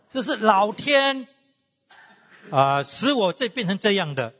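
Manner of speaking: put-on slow, high, whiny little-girl voice, drawn out; lightly voiced spoiled child blaming heaven, slow and high; sulky little girl. Girl answers her angry mother, slow, high and sulky. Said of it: "你知道这小女孩说什么吗".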